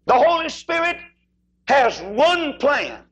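high, ringing preacher's cry; Southern revival preaching swell. Real, not acted. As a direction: drive it home from the pulpit. Preaching at full force.

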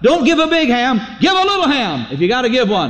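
high, insistent pleading; arguing devil. Retold or imitated. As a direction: argue urgently, pitched well up. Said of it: "the devil argued with him about".

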